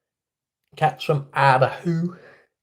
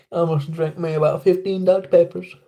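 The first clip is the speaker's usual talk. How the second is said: put-on Southern accent; 3 semitones higher than usual. Streamer doing Southern chat voices.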